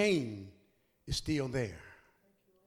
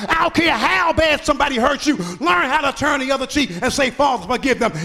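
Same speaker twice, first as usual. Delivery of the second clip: loud, higher, building preacher's cry; full-force preaching; 6 semitones higher, 8 dB louder than usual. Acted. Pastor to congregation on the cross, climaxing sermon shout. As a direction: drive each image home louder and louder.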